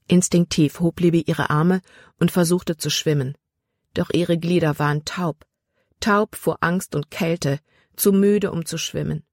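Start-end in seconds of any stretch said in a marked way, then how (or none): none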